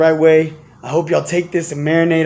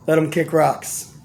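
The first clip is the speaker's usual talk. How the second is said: normal talking voice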